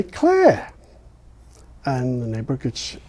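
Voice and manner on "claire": put-on English-accent Claire. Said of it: "And she would say".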